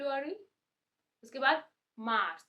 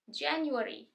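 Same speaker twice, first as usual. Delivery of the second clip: ordinary talk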